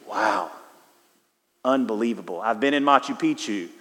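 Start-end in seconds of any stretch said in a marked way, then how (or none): 0.1-2.4 hushed, low wonder at the Astrodome; quiet awe. Retold or imitated. Preacher recalling the Astrodome, quiet hushed awe. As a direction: breathe it out in awe, softer and lower. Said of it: "and thinking".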